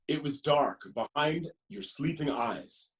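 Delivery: lively, sing-song picture book reading; reading to children. Acted. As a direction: read it like story time for little ones.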